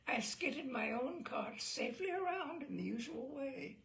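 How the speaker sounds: usual speaking voice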